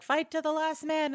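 high, rousing commander's call; a hammed-up wizard ordering the troops; Gandalf rallying. Gandalf ordering exhausted soldiers to fight, mock rousing.

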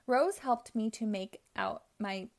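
plain reading voice

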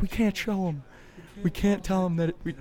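high, mock panicky voice; panicked friend. Friend not wanting to tell the others, high panicky mock voice. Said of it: "he's like".

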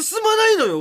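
high-pitched scolding cry; exasperated complaint. Pretend. Co-host despairing at the stalled announcement, high exasperated cry. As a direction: burst out in comic exasperation.

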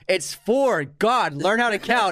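hammed-up angry commenter; mock angry. Reading angry hater comment.

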